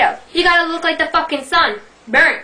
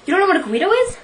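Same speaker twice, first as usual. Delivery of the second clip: ordinary talk, nothing marked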